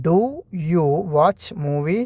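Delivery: "Do" set apart, then a very slow "you watch movie?"; slow, split. Shown as a demonstration. Teacher repeating the question for learners, slow and broken. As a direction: repeat it slowly with a break after "Do". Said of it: "അതെങ്ങനെയാണ് നമ്മൾ ചോദിക്കുക?".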